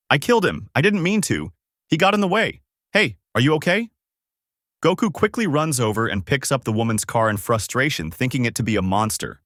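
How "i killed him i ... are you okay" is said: higher-pitched, mildly panicked exclamation; alarmed exclaiming. A narrator's reading. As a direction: read her outburst higher, without shouting. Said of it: "The woman exits her vehicle exclaiming".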